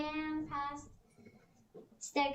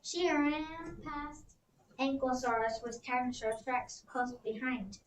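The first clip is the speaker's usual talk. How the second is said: ordinary delivery, nothing marked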